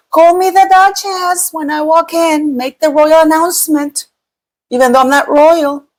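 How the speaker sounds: high, haughty mock duchess voice; snooty mock impression of a would-be royal; mocking Meghan. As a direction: lift the pitch and play the entitled royal demanding her title. Mocking Meghan's demands for titles.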